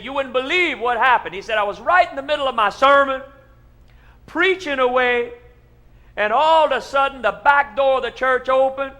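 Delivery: loud, high, building preacher excitement; excited tall tale. Acted. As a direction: tell it big and breathless. Pastor tells wild tall tale.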